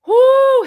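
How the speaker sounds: high loud whoo; a loud high whoop of outrage. Retold or imitated. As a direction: let out a sharp high whoo. Livid at drunk groom.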